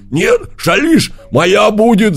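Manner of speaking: high, loud wolf cry; high loud wolf shout. A narrator's reading. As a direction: yell it as the wolf dives in. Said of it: "закричал волк".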